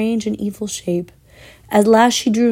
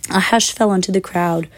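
plain reading voice

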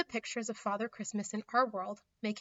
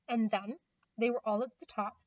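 plain reading voice

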